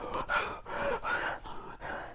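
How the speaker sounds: exaggerated open-mouthed panting; hammed-up breathless gasping; mimicked running gasps. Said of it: "She's always running like".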